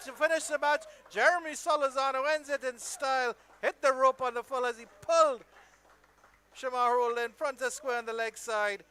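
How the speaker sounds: loud, high-pitched call of the winning hit; excited live commentary. Real, not acted. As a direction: shout the match-winning six as it happens. Live call of match-winning six.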